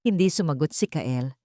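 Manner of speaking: ordinary delivery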